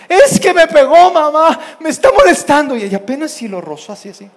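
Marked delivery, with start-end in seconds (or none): 0.1-2.8 high, loud tattling wail; yelling child. Retold or imitated. Child in the car tattles to mother, yelling. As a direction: protest loudly like a wronged kid. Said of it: "se andaban gritando, peleando".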